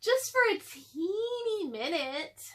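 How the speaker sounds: put-on high, slow coaxing girl's voice; pleading Annie. A narrator's reading. Annie coaxing her brother to let her climb, high coaxing voice. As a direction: wheedle it sweetly. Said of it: "said Annie".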